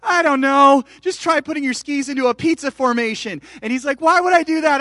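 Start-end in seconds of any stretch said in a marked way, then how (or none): 0.0-3.4 exasperated shout. Retold or imitated. Dad shouts instructions at son. 4.0-4.8 protesting son. Retold or imitated.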